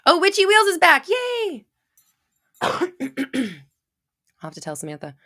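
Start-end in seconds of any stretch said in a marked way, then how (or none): 0.0-1.6 high, loud delighted welcome; excited cheer. Real, not acted. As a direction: cheer a returning friend, pitch way up. Delighted greeting of returning viewer.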